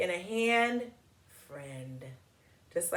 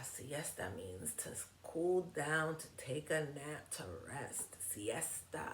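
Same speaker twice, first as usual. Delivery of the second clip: low, much softer, drowsy; sleepy hush; 4 semitones lower, 11 dB quieter than usual. Shown as a demonstration. Teacher explaining siesta to kids, soft and sleepy. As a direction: wind down like nap time.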